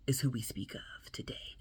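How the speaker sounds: hushed whisper, secretive. Acted. Vlogger introducing the topic, hushed whisper. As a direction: let it drop to a whisper. Whispered aside after the name.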